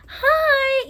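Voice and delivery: high, loud bubbly greeting; cheery hi. Retold or imitated. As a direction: chirp a bright hello in their face. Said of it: "you could have been like".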